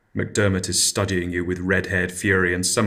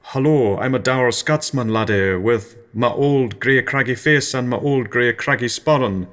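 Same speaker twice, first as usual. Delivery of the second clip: mock a dour Scottish laddie; dour Scotsman; 3 semitones higher than usual. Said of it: "I don't want to offend you".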